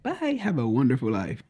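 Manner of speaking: high, sing-song mocking bye; sarcastic goodbye. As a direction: imitate a dismissive brush-off. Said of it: "dismiss me with the sarcasm".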